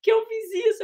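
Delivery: high, loud mock-crying wail; imitating crying daughter. Mother imitating her daughter crying about losing her voice. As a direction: whine like a teen in tears. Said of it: "ela começou a chorar".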